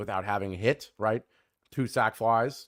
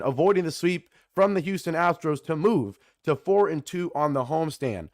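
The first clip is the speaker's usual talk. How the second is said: ordinary delivery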